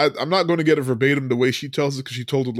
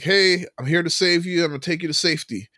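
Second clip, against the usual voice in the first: lightly acted rescuer calling out, higher pitch; rowboat rescuer; 5 semitones higher, faster than usual. Man in a rowboat offers a flooded-out woman rescue, lightly acted hail. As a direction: imitate a would-be rescuer's hail. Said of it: "the guy comes by in a rowboat".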